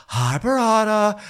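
put-on high, slow baby talk to the cheese; cooing to food. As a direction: coo at it like a baby. Greeting the cheese lovingly.

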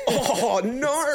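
high, stretched surfer drawl; surfer dude voice. Retold or imitated. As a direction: play a laid-back surfer. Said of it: "the most generic surfer voice".

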